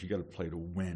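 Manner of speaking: ordinary talk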